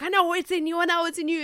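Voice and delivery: urgent, pleading director; intense director voice. Retold or imitated. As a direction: press it on her, insistent. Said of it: "he's like".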